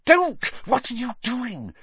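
high, alarmed hiss; urgent hiss. A narrator's reading. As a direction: hiss it urgently to stop someone. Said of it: "Harry hissed".

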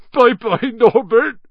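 sobbing goodbye. Acted. Hagrid saying goodbye to his baby dragon, sobbing. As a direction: wail it through tears. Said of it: "Hagrid sobbed".

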